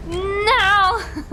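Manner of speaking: high, loud real cry of dismay; anguished no. Real, not acted. Streamer crying out as his game run fails, dismayed.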